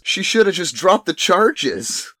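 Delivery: high, loud, slow complaint; whiny hero. Retold or imitated. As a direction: complain loudly and slowly. Voicing forty-five.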